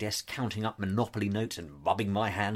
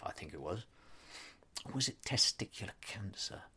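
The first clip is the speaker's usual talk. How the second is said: whispered aside; 10 dB quieter than usual. Real, not acted. Aside, unsure.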